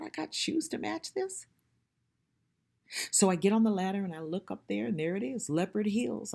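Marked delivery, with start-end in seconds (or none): 0.0-1.5 pitched up in disbelief; mock amazed question to herself; surprised thought. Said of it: "And I thought".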